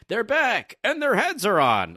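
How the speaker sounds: very high mock exclamation; amazed onlookers. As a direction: cry it out high in hammed-up amazement. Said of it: "The other people are saying this".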